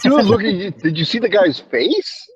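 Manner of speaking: mock bro voice. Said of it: "He's like".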